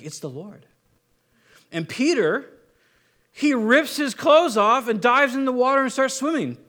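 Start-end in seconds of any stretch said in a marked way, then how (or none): 0.0-0.7 hushed, low and much softer; near whisper. Acted. John to Peter in the boat, hushed aside. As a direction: say it under your breath, not quite whispering. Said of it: "John whispers, or he doesn't whisper".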